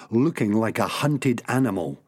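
usual speaking voice

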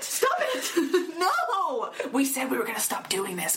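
put-on high, laughing scold at quarreling kids; mock exasperated scolding. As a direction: squeal it like a fed-up parent. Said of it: "United Nations like".

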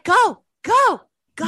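high, loud mock honking driver; squeaky impatient nag. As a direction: yell go like the car behind you. Mocking impatient driver behind.

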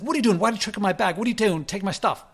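retold high, loud accusing shout; mock a stranger yelling in his face; angry confrontation. Stranger accusing him of taking his bag, loud and angry.

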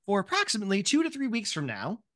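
quick bureaucratic patter; fast, businesslike form-filling manager; brisk clerk. Acted. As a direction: rattle off the paperwork fast. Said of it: "they are like".

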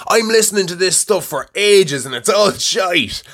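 high mock whiny critic; mocking the hater. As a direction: parody the complaining listener. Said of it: "like, you know".

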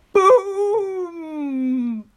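high, long imitated boom; drawn-out boom. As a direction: stretch the boom out like a sound effect. Narrator voices a loud boom.

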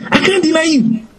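high, tight hammed-up Peter; squeaky mock boast. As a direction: squeak out the promise. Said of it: "Peter was saying".

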